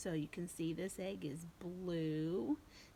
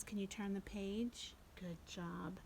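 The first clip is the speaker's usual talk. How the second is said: whisper; 5 dB quieter than usual. Real, not acted. Whispered classroom prompt.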